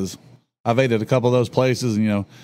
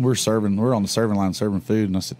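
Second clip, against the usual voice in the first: usual speaking voice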